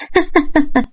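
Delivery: a bright, soft giggle; girlish laugh. Acted. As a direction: giggle lightly at the joke. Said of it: "قالت سارة بضحك".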